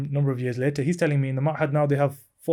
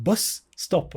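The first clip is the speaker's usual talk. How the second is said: "Bus stop" higher, split into two separate words; emphatic repetition; 4 semitones higher than usual. Retold or imitated. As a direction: say "Bus stop" word by word to someone not understanding. Repeating for confused phone listener.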